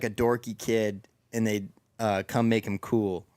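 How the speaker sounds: ordinary talk, nothing marked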